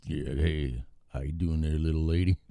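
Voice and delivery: low, soft Texas cowboy greeting; Texas accent. Retold or imitated. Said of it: "Austin, Texas".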